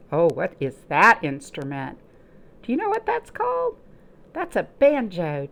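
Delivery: ordinary delivery, nothing marked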